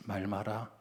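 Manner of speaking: father's punchline in light Jeolla dialect; 전라도 사투리로 툭 던지듯. Retold or imitated. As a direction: tell it like an old Jeolla man, deadpan. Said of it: "전라도 말로 하겠습니다".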